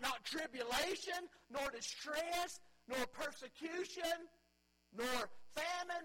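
loud, high, impassioned preaching, slowing on the list; preaching at full force. Acted. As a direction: drive the list home from the pulpit.

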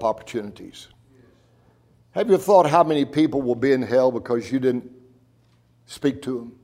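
hushed pastor's invitation. Acted. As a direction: draw the congregation in gently. Quiet altar-call invitation.

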